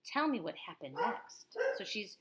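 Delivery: ordinary delivery, nothing marked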